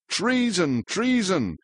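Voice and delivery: high, repeated falling cries; high-pitched outcry at reading volume; mild shriek. A narrator's reading. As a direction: shriek it lightly, twice over. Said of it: "shrieked Her Majesty of the Mouth".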